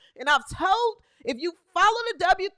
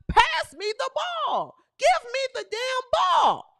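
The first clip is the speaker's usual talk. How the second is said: slow, higher shouted demand; mock player demanding the ball; imitating a demand; 8 semitones higher, slower than usual. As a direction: bark it like the star who wants the ball. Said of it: "tell them".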